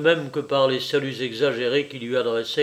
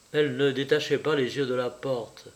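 usual speaking voice

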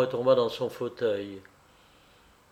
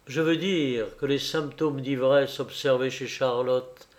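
usual speaking voice

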